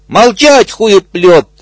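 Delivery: commanding bark. Acted. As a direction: shut him up with a shout. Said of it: "ответил крокодил". (громкий приказ крокодила бегемоту)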